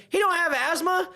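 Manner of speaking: high, loud mock disbelief; the dad outing the faker, hammed up; incredulous dad. Dad tells the coach his son has no asthma, mock incredulous. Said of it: "my dad goes".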